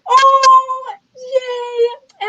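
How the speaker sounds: hammed-up crying with joy; mock happy sob. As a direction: gush through fake happy tears. Mock happy tears over the couple.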